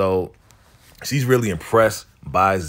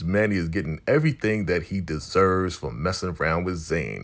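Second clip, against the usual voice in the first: ordinary talk, nothing marked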